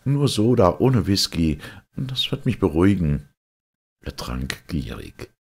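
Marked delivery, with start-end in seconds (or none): 0.1-3.3 higher, insistent refusal; pitched-up, slightly brisk protest; warding off. A narrator's reading. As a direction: decline the drink with mild agitation. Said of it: "wehrte er ab".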